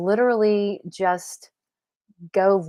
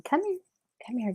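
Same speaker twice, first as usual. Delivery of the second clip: high, soft coaxing call; little high-pitched beckoning; pet talk; 6 semitones higher, 7 dB quieter, faster than usual. Real, not acted. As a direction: coax a pet over in a raised, sweet voice. Calling the pet over.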